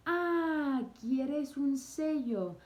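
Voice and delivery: high, slow Spanish clerk offering a stamp. Retold or imitated. Retelling the clerk, asks if he wants a stamp in high slow Spanish. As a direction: play the helpful shopkeeper asking.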